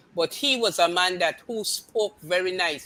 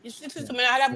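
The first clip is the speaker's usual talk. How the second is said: usual speaking voice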